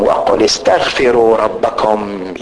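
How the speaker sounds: low, slow recited verse; Quran recitation. Acted. Preacher quoting God's call to seek forgiveness, slow recitation.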